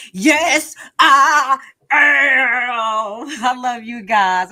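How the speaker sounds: loud high goofy professor voice; Nutty Professor voice. Acted. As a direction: shout it proudly in a cartoon voice. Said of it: "Nutty Professor voice".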